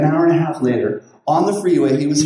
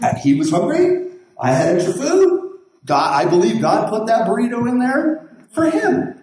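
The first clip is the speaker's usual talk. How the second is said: ordinary talk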